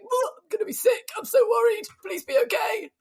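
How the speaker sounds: high, loud worried pleading, shouting at the end; a panicked fan begging her rider to get round safely; anxious pleading. Retold or imitated. Mother watching the rider on TV, high anxious pleading. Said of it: "'cause I'm watching you going".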